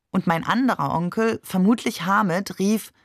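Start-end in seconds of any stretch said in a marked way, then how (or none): none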